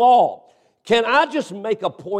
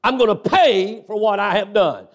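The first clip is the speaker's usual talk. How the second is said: raised, driving preacher voice; preaching; 3 semitones higher than usual. Acted. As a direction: preach it hard to the congregation. Preacher at full force.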